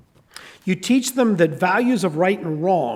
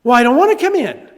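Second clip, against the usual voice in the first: higher, louder protest; stubborn kid; 7 semitones higher, 8 dB louder than usual. Retold or imitated. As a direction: Calvin refusing his dad. Calvin refusing to come in.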